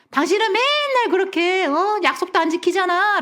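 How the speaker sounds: high-pitched mock accusation; negative spousal complaint. Spouse blaming partner over broken promises, high accusing whine. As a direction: imitate a nagging, blaming spouse. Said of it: "부정적인 말을 배우자가 나한테 했어".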